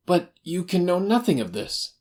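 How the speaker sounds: high-pitched protesting little fellow; nervous man, pitched well up; agitated little man. Acted.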